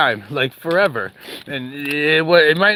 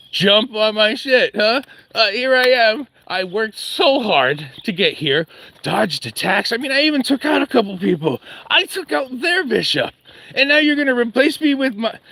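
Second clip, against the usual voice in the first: pitched-up aggrieved pawn, ranting at royalty; indignant pawn; 7 semitones higher than usual. Acted. Pawn ranting at the chess royalty, indignant and higher.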